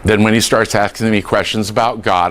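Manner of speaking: usual speaking voice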